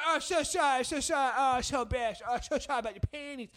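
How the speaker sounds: loud, high, hammed-up apology; apologetic neighbour lady. Man imitating neighbour over dropped laundry, loud high apologies. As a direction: babble sorry in a put-on lady voice. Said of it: "She said".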